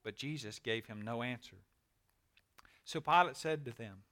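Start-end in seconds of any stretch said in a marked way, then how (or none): none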